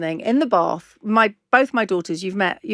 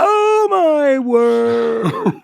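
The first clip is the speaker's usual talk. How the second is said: long, drawn-out, loud higher-pitched cry of amazement; astonished exclamation; 4 semitones higher, 5 dB louder, much slower than usual. Real, not acted. Host realising it was twenty years ago, astonished. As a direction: stretch every word in real astonishment.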